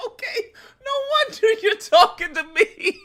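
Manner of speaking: high voice cracking with laughter; laughing. Retold or imitated. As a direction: squeal it through laughter. Laughing retold quote.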